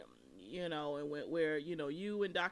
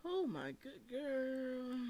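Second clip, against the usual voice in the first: higher, slow stretched exclamation; drawn-out disbelief; 7 semitones higher, much slower than usual. Real, not acted. Host reacting to the rant, stretched-out higher disbelief.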